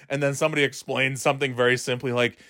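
usual speaking voice

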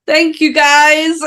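high, loud, slow thank-you; milk the thanks with exaggerated pride; mock proud thanks. Real, not acted.

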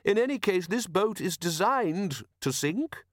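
high-pitched inventor, drawn out at the end; mild eccentric inventor. A narrator's reading. Inventor explains his boat to doubtful watchmen, diplomatic. Narrator voices the inventor Leonard.

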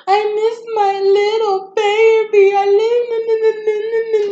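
high, loud, sing-song mock wail; imitating mom's hammed-up sobbing over the baby; mock crying mother. Mocking mom who cries missing her granddaughter, hammed-up wail. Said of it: "she cries and cries".

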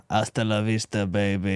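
half-hearted Austrian action-hero catchphrase; badly faked Austrian accent; Arnold impression. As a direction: do a poor Austrian movie-star voice. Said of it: "can you do an Arnold".

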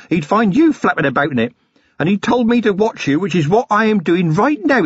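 high, proud and needling; cocky young constable. A narrator's reading. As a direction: puff up your rank. Young constable corrects the crook.

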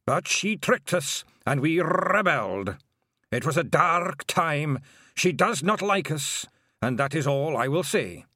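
lightly voiced old Scottish Feagle, slow and grave; a hint of a sorrowful old Feagle elder; old Feagle William. A narrator's reading.

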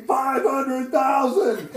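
high, drawn-out mock wail; imitated tearful cry. Man on the ground answering the prophet, imitated weeping. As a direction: cry it out slowly, as if overwhelmed. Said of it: "I'm shaking and weeping".

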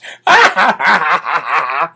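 hammed-up imitated cackle; mocking put-on laugh. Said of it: "cackling Kamala Harris".

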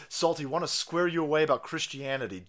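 ordinary talk

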